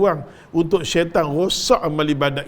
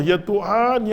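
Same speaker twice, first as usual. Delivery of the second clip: normal talking voice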